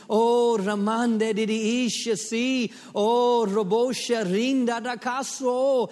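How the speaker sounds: loud, high, slow ecstatic tongues. Acted. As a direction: pour out tongues at full force.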